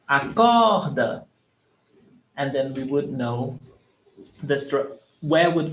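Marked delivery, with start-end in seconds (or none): 0.1-1.2 "Acorda" called slowly, COR high and long; calling out stress. Shown as a demonstration. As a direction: call out "Acorda", stretching the COR. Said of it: "Okay, call it out".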